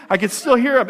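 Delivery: normal talking voice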